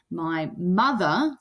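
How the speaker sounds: "My" light, "mother" heavier and drawn out; slow down and lean on "mother"; stress on mother. Shown as a demonstration. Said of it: "my is said really quietly".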